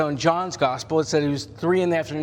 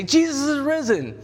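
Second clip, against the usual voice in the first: higher, bright cry of news; excited news; 10 semitones higher than usual. Retold or imitated. Women telling the disciples Jesus is risen, excited higher cry. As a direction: burst out with the good news. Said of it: "they run back ... and they say".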